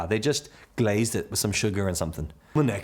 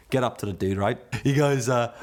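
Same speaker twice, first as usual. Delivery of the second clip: usual speaking voice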